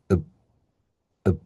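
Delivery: "ub" with a short unstressed schwa; schwa demo. Shown as a demonstration. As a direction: reduce the vowel of "ub" to a brief uh. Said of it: "That's schwa at work".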